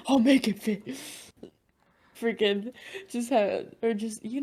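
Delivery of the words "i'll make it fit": louder, teasing mimicry of a friend; mock-confident, loud repeat; mocking echo. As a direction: parrot it back to tease. Mocking the friend's reply back.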